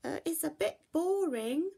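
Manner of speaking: bored little bear. Acted. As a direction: sigh it out like a bored cub.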